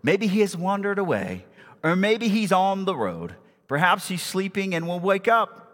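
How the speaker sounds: mock encouragement in a lifted voice; mocking taunt. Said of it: "Elijah mocked them".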